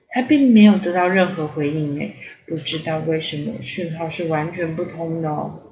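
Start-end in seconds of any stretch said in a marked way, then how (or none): none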